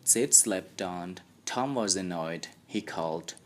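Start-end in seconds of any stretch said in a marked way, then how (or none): none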